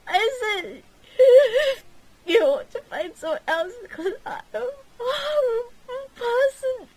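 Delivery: high, loud crying wail; wailing sob. Acted. Catherine repeats her confession to Gilly, wailing and loud. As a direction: wail it through tears.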